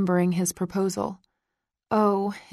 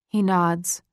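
ordinary delivery

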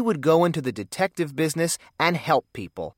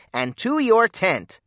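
commanding; 8 semitones higher, slower than usual. Acted. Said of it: "commanded Encyclopedia".

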